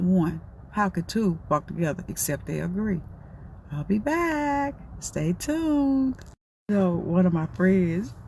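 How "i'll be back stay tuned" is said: higher, slow put-on announcer voice; mock sign-off. Playful break announcement to her viewers, in a slow high announcer voice. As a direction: sign off like a TV host going to break.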